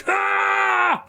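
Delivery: bolt off screaming; panicked scream. Retold or imitated. Bus driver flees bees.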